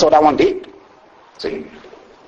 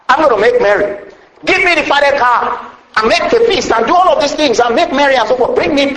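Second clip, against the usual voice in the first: higher, lifted call to celebrate; joyful father; 6 semitones higher than usual. Acted. Father calling servants to the feast, lightly voiced joy. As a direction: let the joy rise as you give orders.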